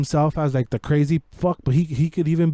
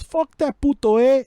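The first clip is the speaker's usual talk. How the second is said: higher-pitched mock tough line; George Lopez imitation; 9 semitones higher, 3 dB louder than usual. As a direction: sneer it like a comic gangster. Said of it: "George Lopez goes".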